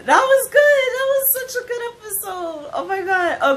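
high, gushing praise; squealing with excitement; excited. Real, not acted. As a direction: rave about it with delight. Reacting to a great episode.

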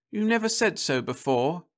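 put-on high, doubtful young woman; Florence's voice. A narrator's reading. Wife doubting her husband's proposal, high and doubtful. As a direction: say it in a high, suspicious voice.

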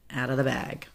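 plain reading voice

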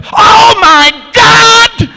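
very high, stretched-out scream; screaming commentators. Retold or imitated. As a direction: scream it in amazement, dragging every word. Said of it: "Can't you hear the commentators?".

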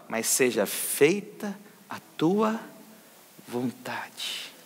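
slow, with "vontade" whispered softly away; fading to a whisper. Shown as a demonstration. Preacher showing the faithful how to end a prayer, fading whisper. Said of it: "mas sempre termine falando".

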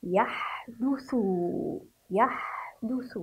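slow يحدث ending in a clear thu; pronunciation demo. Shown as a demonstration. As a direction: draw out يحدث, voicing the damma on ث. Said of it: "فكيف سننطقه؟". (نطق يحدث ببطء مع ضم الثاء)

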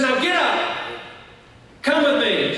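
high, forceful acted command; commanding order. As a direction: order a frightened man onto his feet. Evangelist orders the man up.

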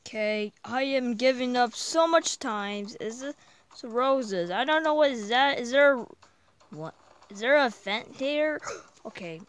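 normal talking voice

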